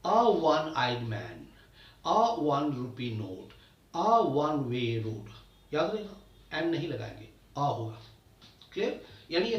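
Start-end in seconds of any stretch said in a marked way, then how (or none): 0.0-5.3 very slow, each word spelled out for the class; slow teaching demo. Shown as a demonstration. Teacher modelling article use for students, very slow and deliberate. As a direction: lay every word out slowly like a dictation. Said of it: "तो वहां हम क्या बोलेंगे".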